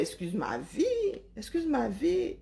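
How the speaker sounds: ordinary talk, nothing marked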